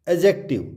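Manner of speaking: adjective said as "a-jective"; no D sound in adjective; silent D. Shown as a demonstration. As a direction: adjective with only a j after the first vowel.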